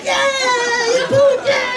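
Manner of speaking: very high cheering praise; encouraging parent. Retold or imitated. Parent praising toddler's potty success, high and gushing. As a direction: gush over the potty win. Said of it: "You gotta be like".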